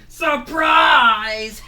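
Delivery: very loud, high aggressive shout; aggressive surprise. Acted. Monsters jumping out at Fango, aggressive shout. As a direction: bark it at him like a threat. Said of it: "They say it very aggressively".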